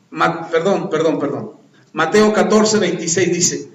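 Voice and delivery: ordinary talk, nothing marked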